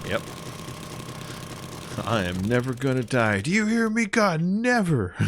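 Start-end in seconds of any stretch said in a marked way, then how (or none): 2.0-5.2 rising to a high cry at God; mock defiant cry. Gamer half-joking to God, high mock defiance. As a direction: playfully defy God in a high voice.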